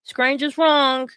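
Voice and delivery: loud, high, drawn-out mocking announcement; mock rallying call. Commenter mimics AJ rallying everyone against Scrange, mocking call. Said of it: "trying to get everyone else".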